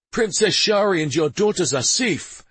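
loud, high reassurance; loudly. Acted. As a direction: call it out so all can hear. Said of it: "he said loudly".

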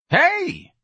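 very high, loud hey; Jones calling out. A narrator's reading. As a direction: holler down the telephone. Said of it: "Jones's voice said over the telephone".

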